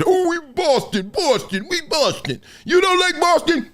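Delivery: high, mocking proud Boston fan voice; a hammed-up Boston fan boasting. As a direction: lampoon the proud local. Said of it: "They ain't gonna ever be that".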